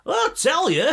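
very high, loud Bolton caricature; hammed-up broad northern shouting; Paddy McGuinness. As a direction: imitate an excitable Bolton comic. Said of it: "Paddy McGuinness was talking".

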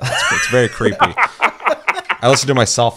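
laughing through embarrassed words; half-laughing confession. Real, not acted. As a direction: giggle it out, a little mortified. Laughing at own creepy ASMR.

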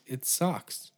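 ordinary talk

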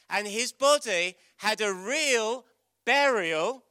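high, loud, slow sermon delivery; fervent preaching. Acted. Preacher telling children Jesus is alive, fervently. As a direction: preach it with conviction.

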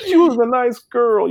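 husband mimicking wife defending her tears; imitating wife. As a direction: put on a high voice to play the weepy wife. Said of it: "And she's like".

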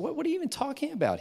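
can't believe what he's hearing; shocked fast protest; incredulous. Retold or imitated. Retold shocked reaction to friend.

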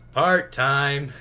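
hammed-up version of the line; bad film take. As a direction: belt it out like an overplayed hero quip. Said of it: "it was a way better take".

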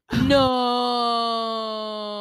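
long, loud, high "no"; drawn-out cry of disbelief; theatrical shocked wail. Retold or imitated. Re-enacting shock at seeing Jolie.

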